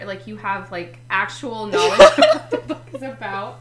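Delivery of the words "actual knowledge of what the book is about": loud, laughing teasing line. Real, not acted. Teasing her co-host about her reading list, loud laughing.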